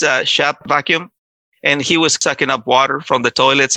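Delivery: ordinary delivery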